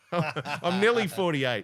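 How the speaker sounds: laughing through the words. Real, not acted. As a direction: say it mid-laugh. Laughing while answering age.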